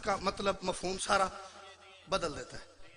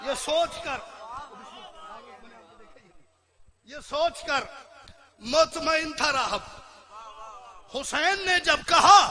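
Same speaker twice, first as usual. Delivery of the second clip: higher, drawn-out recitation with long pauses; poem; 5 semitones higher, 6 dB louder, slower than usual. Real, not acted. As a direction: stretch each phrase, building to कहा. (ऊँचे सुर में ठहर कर शेर पढ़ना)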